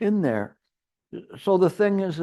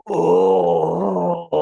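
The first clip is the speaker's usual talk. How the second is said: a loud, higher, moaning "oh"; weary old groan; 4 semitones higher, 5 dB louder than usual. Retold or imitated. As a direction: groan it out like an aching old person. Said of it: "they'll go like".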